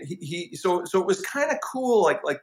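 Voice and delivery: usual speaking voice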